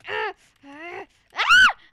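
short cries building to a piercing scream; escalating pretend screams; demo screaming. Shown as a demonstration. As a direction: let out mock screams, the last one very high and loud.

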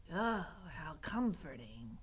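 slow, whispery rat voice; hushed reply. A narrator's reading. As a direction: murmur it slowly under the breath. Rat answers in secret whisper.

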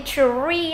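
"tree" stretched as "chờ-ri"; exaggerated blend. Shown as a demonstration. Teacher breaking down the blend, slow stretched "tree". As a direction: pull "tree" apart into CH, R and long ee. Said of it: "nhưng mà mình đọc nhanh này".

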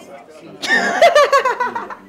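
loud high laugh; shrieking laugh; high cackle. Real, not acted. Laugh, no words.

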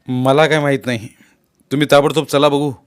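loud, authoritative order; commanding. A narrator's reading. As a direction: speak up like an officer giving orders. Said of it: "हुकमी आवाजात मुलांनी बोलला". (हुकमी, चढ्या आवाजात)

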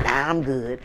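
low, quiet, flat brush-off; low flat refusal. Retold or imitated. Turning down friends' invitations, low and quiet. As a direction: drop it low and uninterested.

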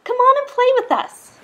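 high, loud playful invitation; calling out. A narrator's reading. Other fish inviting Rainbow Fish to play, high loud call.

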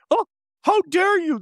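high-pitched, indignant fan imitation; mock outrage. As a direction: squeal it like an offended fan, hammed up. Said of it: "The Swifties get mad".

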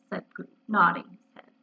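plain reading voice

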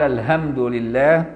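الحمد said slowly with a plain h for ح. Shown as a demonstration. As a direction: drawl الحمد with a breathy h, not ح. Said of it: "بالهاء بدل الحاء".

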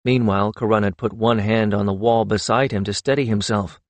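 ordinary delivery, nothing marked

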